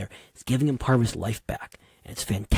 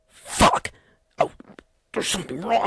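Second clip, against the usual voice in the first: imitating a frantic engineer, voice jumping higher; mock panic, gasped curse then a high cry; panicked engineer; 9 semitones higher than usual. Engineer sees the family crying, imitated panic. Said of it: "the engineer is looking around, is like".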